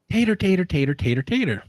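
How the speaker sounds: high, fast Southern mumble of tater; mimicking a local mumbling tater, tater. A man at the health department, imitated in a fast high Southern accent. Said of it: "someone comes in and says".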